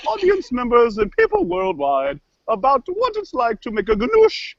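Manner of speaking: swooping, theatrical cooking-host voice; kooky cook character. Acted. Cook apologizes and sneaks a nip of rum, kooky voice. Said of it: "Where'd you learn to talk like that?".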